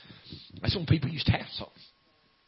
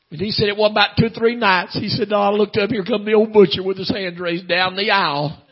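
raised, loud revival storytelling; high-voiced testimony winding down; intoned preaching; 7 semitones higher, 10 dB louder than usual. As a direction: keep the high cadence, easing gradually. Preaching, easing down from climax.

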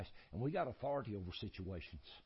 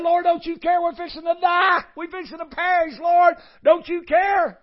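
screamed far higher and louder, terrified; desperate scream; 22 dB louder than usual. Retold or imitated. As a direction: shriek it like you're about to drown. Disciples waking Jesus in storm.

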